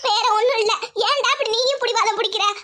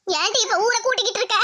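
usual speaking voice

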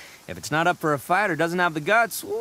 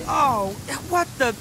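higher, dragged-out disappointment; dismay; 6 semitones higher, slower than usual. Acted. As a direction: wail mildly at the letdown. Find turns out to be melons.

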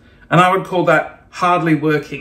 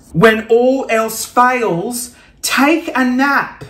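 slow, deliberate reading; 4 semitones higher, much slower than usual. Real, not acted. Vlogger reading a chill pill quote, slow read.

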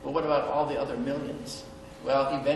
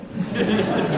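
real loud laugh; 6 semitones higher, 6 dB louder than usual. Real, not acted. Laughing at his own fib.

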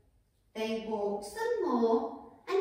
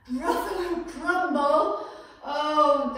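mock shock; 3 semitones higher, 7 dB louder, slower than usual. Gruffalo shocked at being food.

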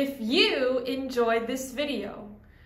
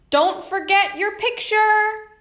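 put-on higher, louder teacher voice calling after a child; teacher reminding; 7 semitones higher, 6 dB louder than usual. Teacher calls after the narrator as she leaves, reminding. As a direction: call it out like a teacher reminding a kid. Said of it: "my teacher said".

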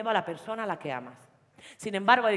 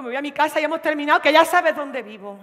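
louder, higher, rushing then slowing; angry ultimatum; 5 semitones higher, 9 dB louder than usual. Acted. Girlfriend telling her boyfriend she is leaving, angry. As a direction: storm out. Breaking up loudly.

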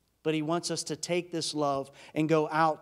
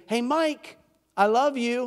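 higher, raised call through a door; 6 semitones higher, 5 dB louder than usual. Acted. Jesus calling to Mike through the door, calling out. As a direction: call out to get his attention, pitched up.

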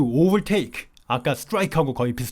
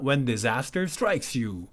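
slow, soft reading; 5 dB quieter, much slower than usual. Shown as a demonstration.

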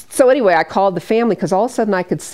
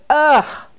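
high groan of annoyance; disgusted groan; 9 semitones higher, 4 dB louder than usual. Retold or imitated. Woman told anniversary is tomorrow, exasperated ugh. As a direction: let out a sharp disgusted ugh.

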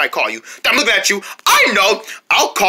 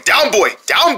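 ordinary talk, nothing marked

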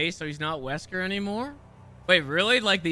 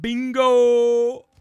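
loud, raised, drawn-out callout; catchphrase shout; 4 semitones higher, 9 dB louder than usual. Acted. Player calling out the line from the game, loud and gleeful. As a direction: belt out the catchphrase.

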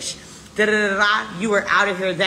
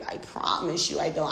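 usual speaking voice

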